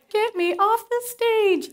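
high, slow pleading; horrified plea. Retold or imitated. On stage, she pleads high and slow to escape. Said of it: "I'm like".

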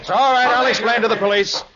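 high, loud call over shoulder. Acted. Nick calling back to the gateman, loud. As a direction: shout back while running off.

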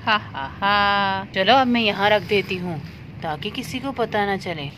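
low, soft sly villain voice; scheming cat villain. Acted. Cat hides the duck from its family, low sly scheming. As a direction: plot quietly like a greedy cat.

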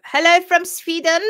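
a playful, hammed-up Swedish accent; mock Swedish accent. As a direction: greet like a cheerful Swede, badly faked. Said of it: "pretend we can do accents".

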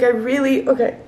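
usual speaking voice

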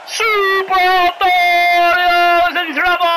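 slow, stretched-out commentator's cry; dramatic match call. Acted. Commentator sees Super Antonio in torture rack, dramatic cry. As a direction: draw the words out long for drama.

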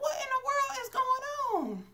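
very high-pitched incredulous reaction; mock bewilderment. Moviegoer baffled by a sobbing stranger, high-pitched mock bewilderment. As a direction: squeal it in baffled disbelief.

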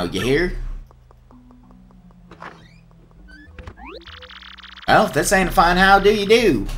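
Southern twang for a cheerful ringmaster; southern accent. Acted. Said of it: "I love this accent I'm giving him".

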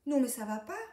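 put-on loud outraged child's shout; furious yell over a smashed sandcastle; cry of protest. A narrator's reading. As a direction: shout at the one who smashed your castle. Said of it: "crie Dédé".

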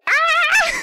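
high, loud "ah"; startled yelp. Real, not acted. Streamer clicked the wrong thing, startled yelp. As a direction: yelp at a sudden mistake.

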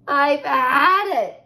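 slow, loud, high sigh; exasperated sigh. Acted. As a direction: groan it out worn out. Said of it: "she sighed".

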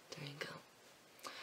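slow, soft whisper. Real, not acted. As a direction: trail off in a whisper. Whispered sign-off.

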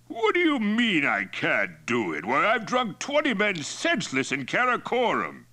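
put-on high, loud drunken bluster; Mongol chief Toktai, indignant boasting.

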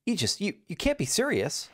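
high incredulous; disbelieving, voice up; surprised. Acted. Voices NPC.

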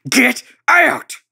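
loud, high yell with a break between GET and OUT; GET... OUT, two separate angry blasts; furious repeated demand. A narrator's reading. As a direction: shout each word separately, full force.

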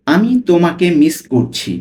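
ordinary talk

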